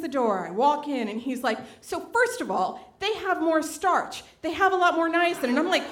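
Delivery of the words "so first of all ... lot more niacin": raised, deliberate explaining voice; a hint of a know-it-all; mock lecturing friend. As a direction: patiently list the facts. Friend lecturing about plantain nutrition.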